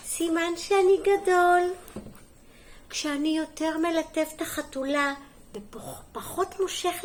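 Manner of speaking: lively, sing-song picture-book reading; story reading to children. Acted. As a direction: read it clearly to small children, lifting the key words. (הקראת סיפור לילדים)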